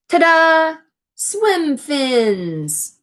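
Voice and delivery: loud, high triumphant ta-da; bright showman's fanfare; excited reveal. Acted. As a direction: announce it like a magic trick. Grand reveal of invention.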